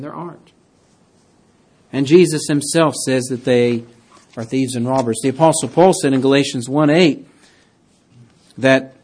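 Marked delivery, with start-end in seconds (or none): none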